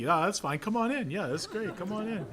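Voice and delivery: high, carefree come-on-in; mock easygoing front desk, pitched high; breezy receptionist. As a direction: wave the stranger through cheerfully. Receptionist breezily admitting a stranger.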